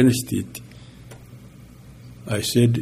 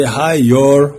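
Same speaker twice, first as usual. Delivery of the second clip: Texan greeting; 2 semitones higher, 6 dB louder than usual. Shown as a demonstration. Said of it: "picked up the Texan accent".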